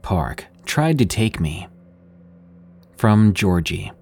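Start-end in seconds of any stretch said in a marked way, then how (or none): none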